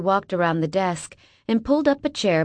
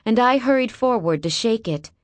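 ordinary delivery, nothing marked

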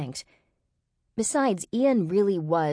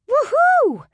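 high, loud whoop of triumph; excited cry; 10 dB louder than usual. A narrator's reading. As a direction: whoop with joy at full voice. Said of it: "Ray cried".

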